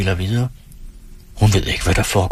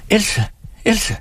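high, worried calls to rouse her; high-pitched alarmed pleading; anxious calling; 10 semitones higher than usual. Acted. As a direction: try to wake the fainted girl. Aunt calls to fainted girl.

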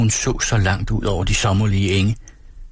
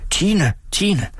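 high calling through the door; 10 semitones higher than usual. A narrator's reading. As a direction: call Tine urgently. Mother hammering on door. (kalder højt på Tine)

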